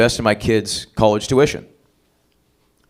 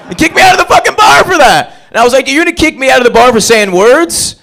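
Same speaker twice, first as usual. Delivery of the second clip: loud, high-pitched indignant yell; incredulous comic outrage; outraged shout; 12 semitones higher, 8 dB louder than usual. Retold or imitated. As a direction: protest at the top of your voice. Outraged retelling of being kicked out.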